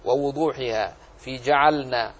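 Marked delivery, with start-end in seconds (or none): 1.4-2.1 "جعلنا" with an audible still L before the N; clearly shown lam. Shown as a demonstration. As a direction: keep L and N apart in "جعلنا". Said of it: "أظهر، احرص على". (إظهار اللام ساكنةً واضحة في "جعلنا")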